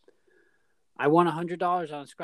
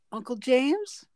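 put-on child's unsure question; 11 semitones higher, 4 dB louder, slower than usual. Little niece voiced, unsure.

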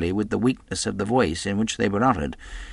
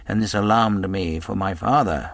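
plain reading voice

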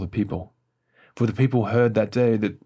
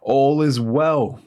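slow, drawn-out raised call; crying out; 4 semitones higher, 5 dB louder, much slower than usual. A narrator's reading. Runner reports to the king, crying out. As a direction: call it out higher, louder and drawn out. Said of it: "Ahimaaz cried out to the king".

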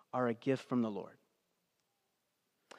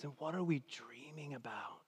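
whisper; 10 dB quieter than usual. Real, not acted. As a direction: drop into a whispered question. Rhetorical question in sermon.

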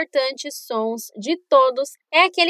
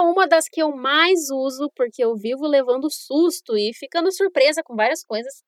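ordinary talk

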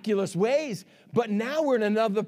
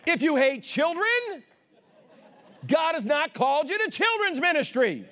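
emphatic preacher voice, higher than normal talk; full-force preaching; 9 semitones higher than usual. Real, not acted. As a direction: punch each phrase out to the congregation.

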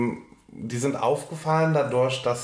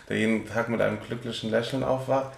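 ordinary talk, nothing marked

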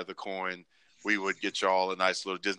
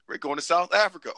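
mock announcer; 8 semitones higher, 3 dB louder than usual. Voicing tour announcement.

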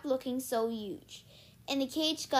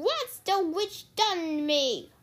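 slow, raised pirate outcry; bellowing pirate; 6 semitones higher, 4 dB louder, slower than usual. A narrator's reading. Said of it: "bellowed the big man".

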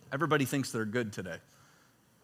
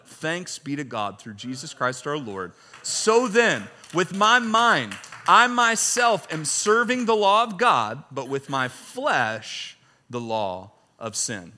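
raised, declaiming reading voice; scripture reading; 5 dB louder, slower than usual. Acted. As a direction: read Paul's words like a proclamation. Reads scripture aloud, slowing.

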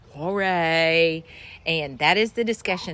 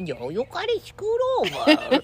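husband's higher cooing voice; baby talk; 8 semitones higher than usual. Retold or imitated. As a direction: coo it to the dog. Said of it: "baby talks".

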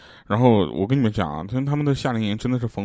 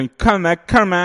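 bragging; 9 semitones higher, 5 dB louder than usual. Retold or imitated. Showing off money to beggar.

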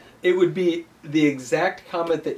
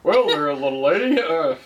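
cowboy charmer; 6 semitones higher than usual. Pretend. Jokey cowboy voice continues.

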